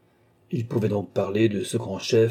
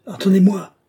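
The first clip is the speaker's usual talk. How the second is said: louder, higher call; shouted; 10 semitones higher, 7 dB louder than usual. A narrator's reading. Captain Sévra calling to Athanase from the octroi, loud shout. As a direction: shout after him across the station. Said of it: "cria Sévra".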